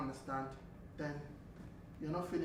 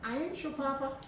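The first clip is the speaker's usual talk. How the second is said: higher-pitched attempt at a Southern US accent; 9 semitones higher than usual. Shown as a demonstration. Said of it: "now you trying to go to the South".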